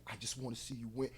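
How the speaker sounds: very soft, low mimicry of a rapper. Host questions the rapper's meaning, hushed imitation. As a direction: drop to a hush to imitate him. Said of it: "What is he really even saying?".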